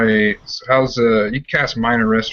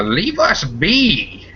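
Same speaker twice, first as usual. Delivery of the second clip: slow, higher warning from behind a door; voice from inside; 8 semitones higher, much slower than usual. Acted. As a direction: warn them off through the door.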